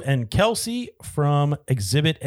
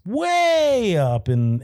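drawn out; 9 semitones higher, much slower than usual. Acted. Drawn-out emphasis.

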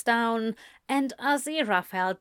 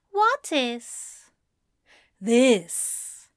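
fussy angel voice; 3 semitones higher, 4 dB louder, much slower than usual. A narrator's reading. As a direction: fret like a worried angel. Angel and demon dialogue.

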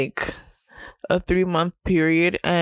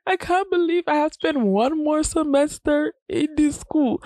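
mock wail; 9 semitones higher than usual. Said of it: "I was like".

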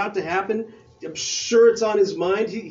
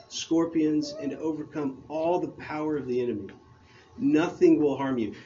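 softer, measured reading of the verse; scripture reading; 5 semitones lower, 4 dB quieter than usual. Acted. Pastor reading Jesus' words to the church, soft and grave. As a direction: read it quietly and gravely.

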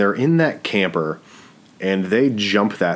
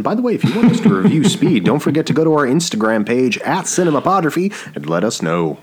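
excited plug; 7 semitones higher, 4 dB louder, faster than usual. Real, not acted. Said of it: "plugging the podcast Instagram".

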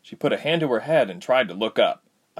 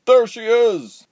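high, slow, loud yell; 14 semitones higher, 8 dB louder, slower than usual. A narrator's reading. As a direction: call it out across the field. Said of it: "someone yelled".

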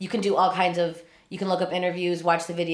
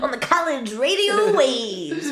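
DJ persona; 6 semitones higher, 3 dB louder, slower than usual. Pretend. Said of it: "your super DJ name".

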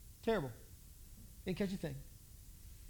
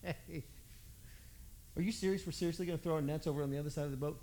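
quick, softer lower disbelief; fast grumble; 4 semitones lower, 7 dB quieter, faster than usual. Retold or imitated. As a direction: rattle it off incredulous. Incredulous grumbling.

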